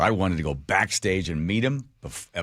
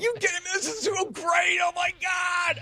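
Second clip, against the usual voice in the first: much higher shouted gushing; ecstatic shout. Retold or imitated. Stoned concertgoer gushing to a neighbor, high-pitched shout. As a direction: yell it out, overjoyed and wasted.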